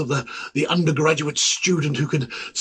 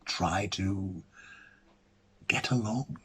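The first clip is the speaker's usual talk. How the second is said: resigned whisper; 5 semitones lower, 12 dB quieter than usual. Acted. Resigned close to a thought.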